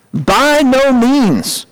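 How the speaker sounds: high, slow, drawn-out exclamation; emphatic refusal. Acted. Preacher reading Paul's answer to the church, emphatic refusal. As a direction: stretch each word out in firm refusal.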